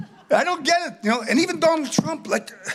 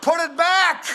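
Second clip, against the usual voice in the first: higher, slow half-shouted scolding; mock yell at shoppers; 8 semitones higher, slower than usual. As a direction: yell at hoarders to put it back.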